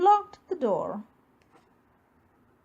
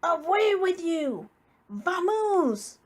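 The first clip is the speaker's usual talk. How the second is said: bear chasing off; 5 semitones higher, slower than usual. A narrator's reading. Bear shooing the mouse out, exasperated. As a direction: shoo the mouse away, fed up.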